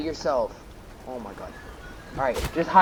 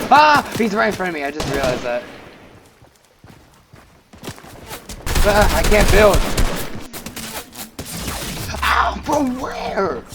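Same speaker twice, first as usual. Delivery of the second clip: panicking gamer; 4 semitones higher, 4 dB louder than usual. Real, not acted. Under fire, gamer panicking.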